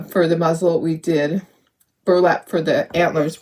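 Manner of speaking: normal talking voice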